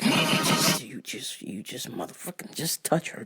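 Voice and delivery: soft, half-whispered warning to a creep; hushed threat. Retold or imitated. Retelling her warning to the coworker, a soft hushed threat. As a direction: threaten him under your breath. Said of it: "I was like".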